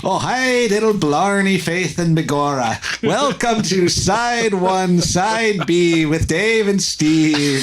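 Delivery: high, slow stage Irish leprechaun voice; hammed-up leprechaun Irish accent. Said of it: "do the intro in the, the leprechaun accent".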